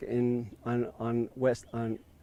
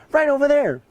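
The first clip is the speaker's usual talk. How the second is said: a much higher, louder shout; retold yelling; 12 semitones higher, 7 dB louder than usual. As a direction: shout the directions across the course. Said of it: "he's yelling at me again".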